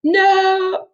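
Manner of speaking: ordinary delivery, nothing marked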